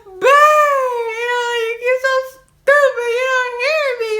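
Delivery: high, loud crying wail at him; retold sobbing rant. Wife sobbing at husband asleep on the couch, tearful wail. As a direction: wail it through tears, slowly.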